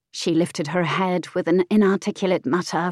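ordinary delivery

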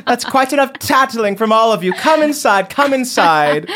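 sweet but firm, shoo them all inside; fussy mother. Acted.

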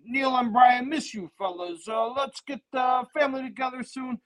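high, slow Scottish attempt slipping into Italian; botched high-pitched Scottish accent; badly faked Scottish accent. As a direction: fake Scottish and miss towards Italian. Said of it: "a Scottish accent".

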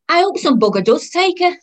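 high, loud exasperated moan; brash, fed-up mother; loud griping.

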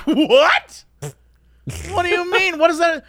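high, loud incredulous outburst; mock disbelief. Crowd in line reacting to the drunk, high mock disbelief. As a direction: burst out baffled, pitch shooting up.

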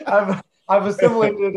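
high laughing reply. Real, not acted. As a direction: laugh it off, amused. Laughs at Jersey accent remark.

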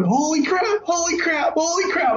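very high, freaking-out squeal; excited yelling. Retold or imitated. Dad jumping up and down at the news, ecstatic yelling. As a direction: lose control of your voice with joy. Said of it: "yelling it".

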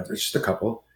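whispered, barely voiced; a guilty little whispered confession to his wife; sheepish whisper. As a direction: admit it under your breath, embarrassed.

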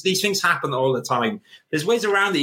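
usual speaking voice

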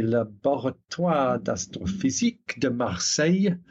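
self-mocking French accent on the name. Shown as a demonstration. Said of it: "decipher my horrible French accent".

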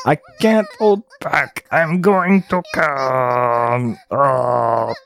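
strained, drawn-out goblin groan; goblin character voice. Acted. Goblin losing control, hammed-up slow creature voice. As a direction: stretch it out, overcome, as a goblin. Goblin figure's line read aloud.

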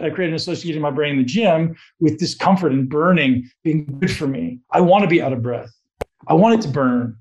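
ordinary talk

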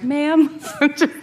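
a high, gentle "Ma'am"; kindly, gently. Retold or imitated. Manager gently calming a customer in the gift shop, kind high tone. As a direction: soothe the upset customer with one word. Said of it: "very kindly was like".

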